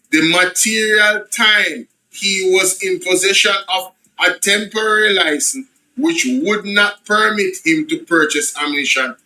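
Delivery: ordinary talk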